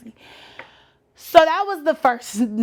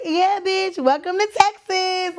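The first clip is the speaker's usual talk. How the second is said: pitched-up, hyped welcome to a friend; excited greeting; 7 semitones higher than usual. Retold or imitated. Greeting her cousin at the curb, excited and pitched up. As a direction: cheer her arrival like you've been waiting all day.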